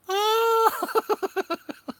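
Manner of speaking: very high, loud mock scream; high cartoon scream. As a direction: shriek as a terrified little snail. Scream Snail cries out.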